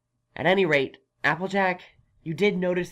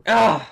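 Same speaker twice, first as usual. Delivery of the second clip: loud exasperated ugh; groan, fed up; frustrated groan; 3 semitones higher, 10 dB louder than usual. Acted. Unicorn giving up on the farmer, loud frustrated groan.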